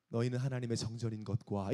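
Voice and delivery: soft, low audio-Bible reading; hushed, mild narrator reading; gentle narration. Shown as a demonstration. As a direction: read the verse very softly and evenly, low-pitched. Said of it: "되게 부드럽게 읽는다고".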